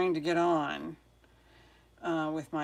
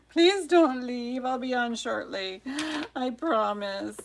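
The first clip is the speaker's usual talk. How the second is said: pleading; 6 semitones higher, 4 dB louder than usual. Real, not acted. Streamer begs waiting viewers to stay, pleading. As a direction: beg them not to go, half joking.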